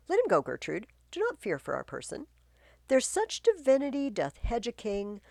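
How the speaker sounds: loud, lofty declamation; calm the queen with royal pride; regal king. Acted. King stands firm.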